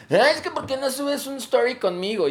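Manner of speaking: high, pleading family member voice; whiny relative voice. Retold or imitated. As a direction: whine like a needy relative. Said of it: "me ha pasado con familiares de".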